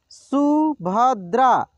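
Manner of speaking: Subhadra drawn out slowly, syllable by syllable; slow sounding out. Shown as a demonstration. Teacher slowly sounds out Subhadra for students. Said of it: "एक एक मात्रा को अलग अलग करके".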